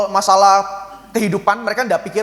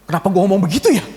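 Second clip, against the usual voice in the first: sheepish realization; faster than usual. Retold or imitated. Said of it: "ini baru inget".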